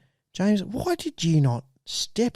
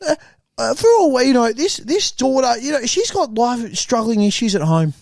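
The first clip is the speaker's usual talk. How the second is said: hammed-up worked-up outrage, pitched higher; mock emotional; 8 semitones higher, 3 dB louder than usual. Reporter gets mock emotional about the carnival injustice. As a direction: play it like you're close to tears over nothing. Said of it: "I know I'm getting emotional".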